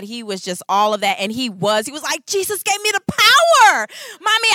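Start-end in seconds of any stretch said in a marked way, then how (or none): 2.3-4.5 put-on high, loud boastful kid voice; proud child. Mother imitating her son after his basket. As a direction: brag like a triumphant little boy.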